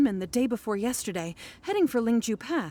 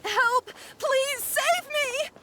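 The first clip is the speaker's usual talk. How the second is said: frightened cry for help; 14 semitones higher, much slower than usual. Acted. Trapped person calling out.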